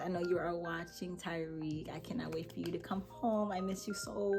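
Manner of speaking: soft, hushed love message; quiet loving whisper to her absent man; tender whisper. Real, not acted. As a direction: whisper it just to him.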